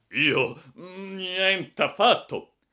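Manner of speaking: high, loud, drawn-out protest; slow, emphatic indignation; indignant denial. A narrator's reading. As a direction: deny it with outraged disbelief. Startled passenger denies the accusation.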